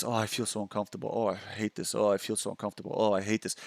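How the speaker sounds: soft, low, miserable self-talk; anxious moaning. Retold or imitated. Man imitating closed-in anxiety, soft and low. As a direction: groan it out like you hate being there. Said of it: "you're like this".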